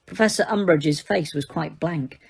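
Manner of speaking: ordinary delivery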